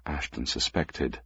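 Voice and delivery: usual speaking voice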